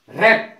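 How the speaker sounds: रैप loud, begun straight on R; silent W. Shown as a demonstration. Teacher presenting wrap, loud रैप with silent W. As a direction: say रैप with no W in front. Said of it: "रैप में W silent है".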